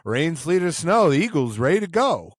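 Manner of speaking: chanting it like a cheerful rhyme; imitating Jalen Hurts. Retelling a grinning post-game interview, mock cheerful chant. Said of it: "he was like".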